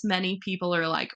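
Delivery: usual speaking voice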